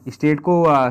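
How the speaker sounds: ordinary talk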